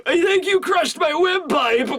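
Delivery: high, strangled comic whine; choking victim. Acted. Guy with soba down his gullet, high choked complaint. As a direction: squeeze it out as if throttled.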